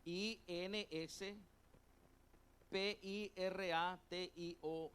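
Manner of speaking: "I N S P I R A T I O" spelled slowly, one letter at a time; slow letter-by-letter spelling. Shown as a demonstration. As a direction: spell the Latin word out slowly for note-takers. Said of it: "acento en la O".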